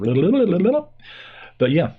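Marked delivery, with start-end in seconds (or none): 0.0-0.9 rising mock babble; babbling mouth. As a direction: gabble like a runaway mouth. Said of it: "my mouth is just running away".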